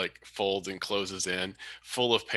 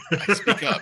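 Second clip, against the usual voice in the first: laughing; 13 semitones higher, 8 dB louder than usual. Acted. Laughing, muted joke.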